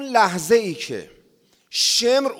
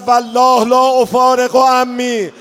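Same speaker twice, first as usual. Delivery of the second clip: moaning oath; 7 semitones higher, 6 dB louder, slower than usual. Acted.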